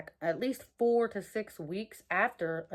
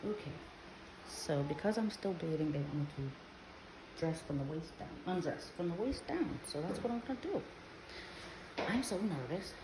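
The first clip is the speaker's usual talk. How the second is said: hushed; 11 dB quieter than usual. Real, not acted. Waiting nervously at doctor's appointment.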